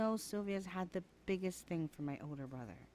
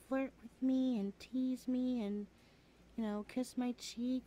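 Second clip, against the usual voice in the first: ordinary delivery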